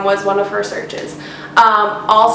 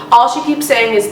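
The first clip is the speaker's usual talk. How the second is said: usual speaking voice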